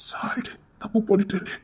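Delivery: soft, breathless whisper of sorry; whispered apology. Acted. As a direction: whisper the apology so no one hears. Apologising in secret. (கிசுகிசுப்பான மன்னிப்பு)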